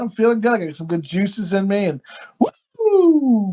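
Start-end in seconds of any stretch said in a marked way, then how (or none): 2.8-3.5 high, drawn-out whoop; Ric Flair woo. Retold or imitated. Feeling good with juices flowing, whooping. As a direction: let out a sudden high wrestler's woo. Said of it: "Ric Flair's thing is he goes".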